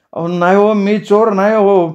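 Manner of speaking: high-pitched plaintive denial; humble pleading. Acted. Captured young man denies being a thief, high pleading voice. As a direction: beg them to believe you, drawing out the end. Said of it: "तसा तो हात जोडत म्हणाला".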